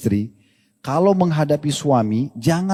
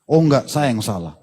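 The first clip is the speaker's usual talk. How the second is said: husband giving in; 4 semitones lower than usual. Retold or imitated. Husband melts, admits fault.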